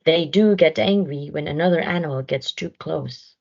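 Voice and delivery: usual speaking voice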